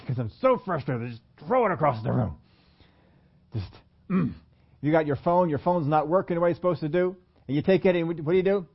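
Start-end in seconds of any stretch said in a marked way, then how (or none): 0.0-4.3 mild acted frustration; frustrated. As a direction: act out being fed up, straining on the throw. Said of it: "you get frustrated with it".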